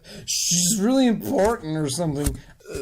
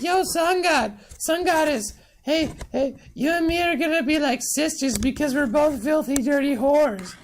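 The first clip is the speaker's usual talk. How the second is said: high-pitched chattering mom voice; mock a pushy mother, pitched way up; brash mother; 10 semitones higher than usual. Paul's mom accosts sun goddess.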